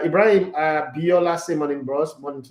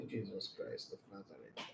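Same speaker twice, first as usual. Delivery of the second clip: whispered exasperation; 9 semitones lower, 25 dB quieter than usual. Real, not acted. Exasperated at a viewer comment.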